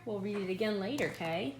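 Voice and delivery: usual speaking voice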